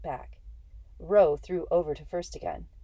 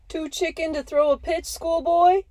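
high loud jeering holler; 12 semitones higher, 7 dB louder than usual. Acted. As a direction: holler the taunt from the dugout. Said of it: "one of the Dodgers hollered".

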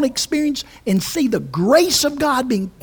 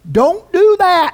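louder, higher, slow warning; flesh screaming; 8 semitones higher, 7 dB louder than usual. Retold or imitated. Flesh screaming a warning at the believer, loud and high. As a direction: shout it like the flesh resisting. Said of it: "your flesh is screaming out".

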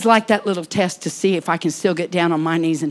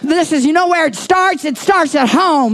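raised, louder preaching voice; 5 semitones higher, 4 dB louder than usual. Acted. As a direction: call the congregation up with fervor. Pastor calls people forward.

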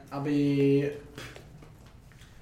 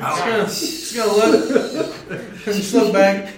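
loud laughing words; 5 semitones higher, 13 dB louder than usual. Real, not acted. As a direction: laugh through the line. Laughing while describing action.

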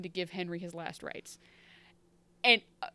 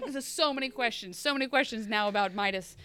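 ordinary talk, nothing marked

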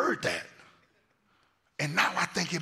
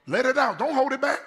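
high, loud call to the congregation; urging shout; 12 semitones higher, 8 dB louder than usual. Real, not acted. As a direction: rouse the whole church with a shout. Urges congregation to let it out.

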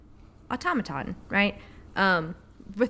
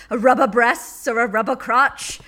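rolled posh Boston-English R's, louder; Sylvia Plath accent; 3 semitones higher, 7 dB louder than usual. Retold or imitated. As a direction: read it like Plath with her strange R's. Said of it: "the way that she does the R's".

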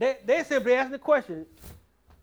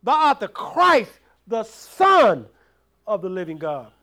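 raised, louder preacher's drive; preaching at full force; 4 semitones higher, 6 dB louder than usual. Acted. Preacher drives the point home to the church, loud. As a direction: push it out to the congregation.

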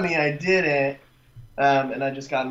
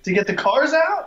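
very high, louder disbelieving question; shocked; 12 semitones higher, 5 dB louder than usual. Real, not acted. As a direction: shoot the voice up in shock. Shocked at tow price.

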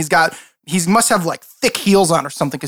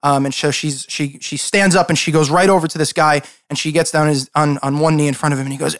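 ordinary delivery

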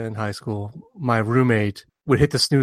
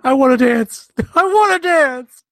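high, loud mock protest; hammed-up high-pitched outburst; whiny pleading; 13 semitones higher, 6 dB louder, slower than usual. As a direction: wail it like a stubborn performer. Acting out a musician wanting to dance.

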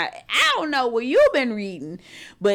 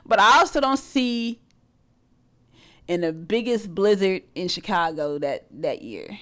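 ordinary talk, nothing marked

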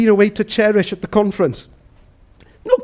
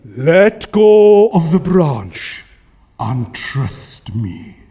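God; 6 semitones lower, much slower than usual. Acted. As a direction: boom the order out, then sink deep on the last words.

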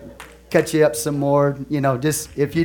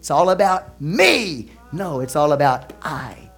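"me, me, me" in a lightly voiced selfish whine; mock selfish voice; much slower than usual. Shown as a demonstration. Preacher mimics the flesh talking, lightly whining "me". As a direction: lightly mimic a self-centred person. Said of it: "I talks like this".

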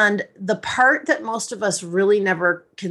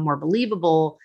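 ordinary talk, nothing marked

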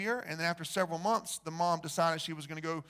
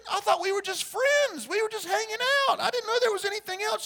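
high, fast, hammed-up babble; hammed-up flustered excuse; 4 dB louder than usual. Boyfriend excusing himself to the lovestruck mother, in a high fast babble. Retold boyfriend apologetic explanation.

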